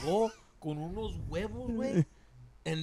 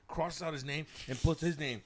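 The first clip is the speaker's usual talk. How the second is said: normal talking voice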